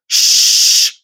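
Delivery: long sh as a single sound; one sound only. Shown as a demonstration. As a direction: hold sh out as one hushing sound. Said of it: "It's one sound only".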